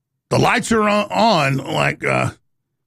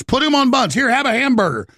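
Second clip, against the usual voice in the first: mock politician; 8 semitones higher than usual. Mocking politician offering burger.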